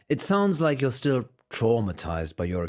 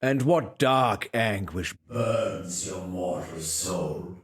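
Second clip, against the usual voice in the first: slow, low, solemn intoning; dramatic priest voice; 2 semitones lower, much slower than usual. Acted. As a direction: intone it like a brooding confessor.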